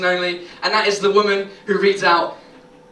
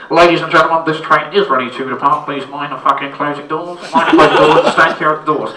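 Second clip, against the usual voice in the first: loud, flat tannoy voice; train driver announcement; 4 semitones lower, 5 dB louder than usual. Retold or imitated. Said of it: "the driver going".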